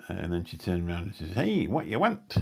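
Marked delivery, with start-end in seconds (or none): 1.3-2.4 mock Scottish lass, high and slow; high, drawn-out Scottish accent. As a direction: imitate a broad Scottish girl asking what you want. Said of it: "she had a full Scottish accent".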